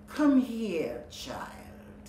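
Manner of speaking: unvoiced, luring witch whisper; beckoning whisper. Sea witch calling Melody closer, whispered. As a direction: beckon her in a hushed whisper. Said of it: "the sea witch beckoned".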